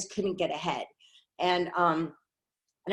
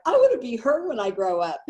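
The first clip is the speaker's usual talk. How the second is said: starstruck gush; 5 semitones higher, 6 dB louder than usual. Retold or imitated. Retelling her first impression.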